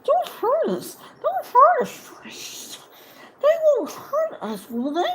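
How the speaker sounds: high, pleading creature cry; whimpering Gollum. Acted. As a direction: beg for mercy, cringing and high.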